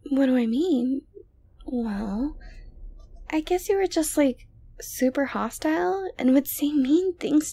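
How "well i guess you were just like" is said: ordinary talk, nothing marked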